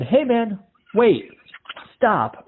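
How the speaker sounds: high-pitched call from behind; Randy calling out. Retold or imitated. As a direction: shout after him to wait and stop.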